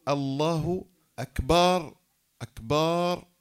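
"أكبر" said slowly as akbaar, long loud final vowel; "أكبر" with a long swelling aa after the b; stretched baa. Shown as a demonstration. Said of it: "بمد الباء".

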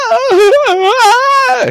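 long, piercing high hammed-up scream; mock screaming. Lucky fakes being another victim, mock screaming. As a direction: shriek loudly in exaggerated panic. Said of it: "start screaming".

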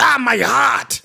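high, slow dying groan; Mickey voice. Retold or imitated. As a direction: clutch the chest and groan. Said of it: "he's like".

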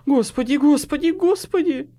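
high, loud gabbled dread, rising; frantic strangled repetition; choked panic. A narrator's reading. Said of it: "произнёс Хват перехваченным голосом".

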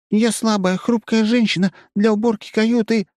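very high meek simper. A narrator's reading. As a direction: play the helpless little woman. Fake woman simpers, meek.

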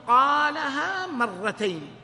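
قالها مرتين drawn out slow and loud, weight on قالها; slow emphatic phrase. Shown as a demonstration. Teacher spelling out the correct phrasing for students, slow and loud. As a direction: spell out قالها مرتين slowly and loudly, stressing قالها. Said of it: "ما تقول مرتين هكذا".

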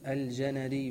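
الجندي said al-ja-na-dee, two short a vowels; two fathas. Shown as a demonstration. Teacher repeats name for student, careful correction. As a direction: open both first vowels of الجندي, ja-na. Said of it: "بفتحتين".

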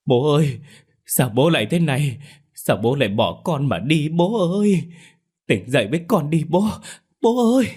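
high, crying lament rising to a final wail; wailing sobs. A narrator's reading. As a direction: sob out the grief, break on the last cry. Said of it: "Tiếng khóc của anh Vũ".

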